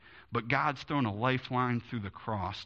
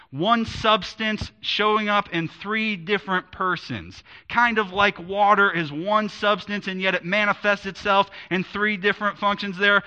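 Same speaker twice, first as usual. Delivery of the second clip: preaching; 6 semitones higher, 5 dB louder than usual. Acted. Pastor explaining the Trinity with water, fervent preaching. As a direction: preach it out to the congregation.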